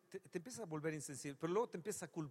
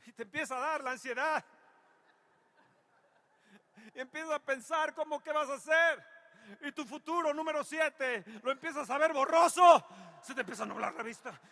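rising, much louder, higher preacher's cry; fervent pulpit preaching, climbing in force; 11 semitones higher, 11 dB louder than usual. Acted. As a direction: build the sermon to a fervent peak. Preaching builds in intensity.

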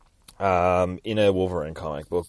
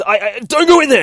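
high, loud frantic yell; panicked shout; 11 dB louder than usual. Acted. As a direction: scream the warning. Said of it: "he says".

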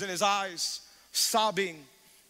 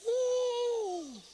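high whoop. Real, not acted. Preacher's exclamation.

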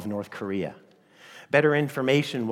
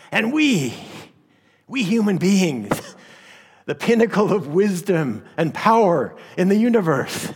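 high, loud mad villain voice; unhinged villain; 8 semitones higher, 6 dB louder than usual. Pretend. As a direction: gloat like a crazed supervillain. Mock villain boast, sermon joke.

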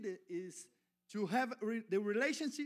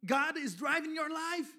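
louder and higher, building to a shout; preaching climax at full shout; shouting preacher; 6 semitones higher, 11 dB louder than usual. Acted. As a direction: rouse the congregation out of fear.

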